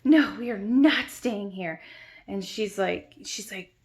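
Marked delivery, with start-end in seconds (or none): 0.0-1.8 loud, emphatic mock outrage; imitated screech. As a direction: retell an indignant refusal, hitting it hard. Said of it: "like, screeches".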